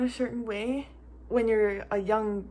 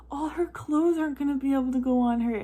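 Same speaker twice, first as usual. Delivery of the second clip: mild high-pitched fretting; a touch of self-mocking wail; 4 semitones higher than usual. Mom panicking about the clothes, fretting. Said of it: "it sounds so stupid, but I'm like".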